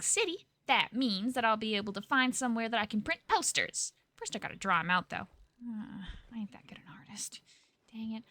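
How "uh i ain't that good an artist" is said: breathy whisper to himself; grumbling whisper about his drawing; whispered aside. Real, not acted. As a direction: whisper it under your breath.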